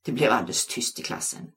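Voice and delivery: ordinary delivery, nothing marked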